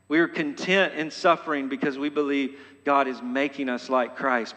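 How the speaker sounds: ordinary talk, nothing marked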